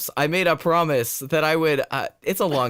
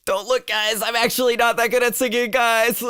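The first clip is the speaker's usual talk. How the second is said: embarrassed pleading; 8 semitones higher than usual. Retold or imitated. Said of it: "it's like".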